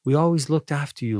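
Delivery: mock indignant disbelief. Apostles protesting to Jesus that they cared for him, baffled and high. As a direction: act baffled at the accusation.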